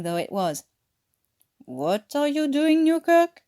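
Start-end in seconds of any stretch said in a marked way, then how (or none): 1.6-3.4 lightly voiced guard. A narrator's reading. Guard questioning a lounging prisoner.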